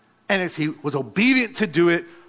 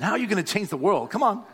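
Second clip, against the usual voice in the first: ordinary delivery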